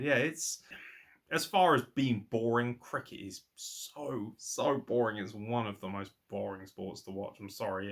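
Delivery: ordinary delivery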